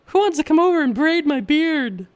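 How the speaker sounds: a cheeky, sing-song invitation; playful high voice. Pretend. Teasing the viewers in a high, playful voice. Jokey invite while wearing costume beard.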